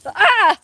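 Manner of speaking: sudden high scream of fright; a real jump-scare shriek; startled scream. Real, not acted. Squirrels suddenly run at her.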